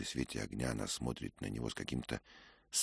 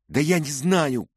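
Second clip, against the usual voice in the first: higher, louder helpless outburst; crying out; 13 dB louder than usual. A narrator's reading. Dick cornered by her questions, crying out. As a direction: blurt it out in frustration. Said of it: "крикнул Дик".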